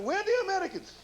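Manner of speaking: a hammed-up, bewildered Iraqi soldier in a generic Arabian accent; mock Iraqi accent. Iraqi soldier wondering where Americans went, mock Arabian accent. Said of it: "Iraqi accent?".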